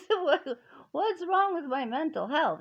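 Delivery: incredulous laugh breaking into the question; laughing, high and loud. Real, not acted. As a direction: laugh it off in disbelief. Laughing at the caseworker's claim.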